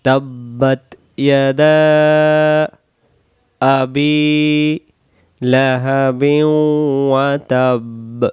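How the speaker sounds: drawn-out melodic recitation; chanted Quranic recitation; slow Quran recitation. Shown as a demonstration. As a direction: recite it slowly and devoutly. Recites verse slowly.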